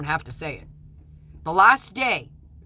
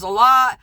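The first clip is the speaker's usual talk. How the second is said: LOT hit loud and high, imitating the judge; pointed stress on LOT; 5 semitones higher, 10 dB louder than usual. Judge leaning on a doctor for a favor, LOT stressed hard. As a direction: lean hard and loudly on "lot". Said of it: "how he said it".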